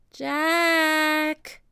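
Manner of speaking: put-on a kid calling her brother; girl calling. Annie calls Jack, high and eager.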